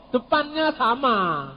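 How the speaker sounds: ordinary delivery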